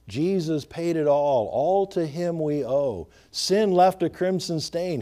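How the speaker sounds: ordinary talk, nothing marked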